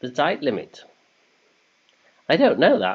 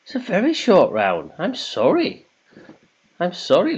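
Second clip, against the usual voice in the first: mock apology; 7 semitones higher than usual. Real, not acted. Host apologises to listeners.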